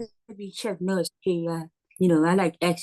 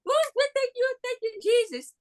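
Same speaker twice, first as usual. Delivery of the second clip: very high-pitched joyful cry; jubilant praise. Real, not acted. Reacting to Jim Kelly being named, jubilant praise. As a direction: send the thanks way up high, like praise. Jubilant thanks after the right name.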